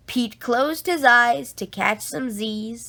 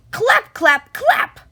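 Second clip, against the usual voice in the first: loud clapping sound; 10 semitones higher, 6 dB louder than usual. A narrator's reading. Sound effect claps in story.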